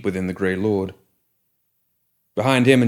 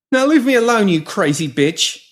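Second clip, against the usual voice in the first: much higher, louder defiant outburst; frightened snarl; 12 semitones higher, 6 dB louder than usual. Acted. Pinky telling the woman to leave him, angry and scared. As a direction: spit the insult out.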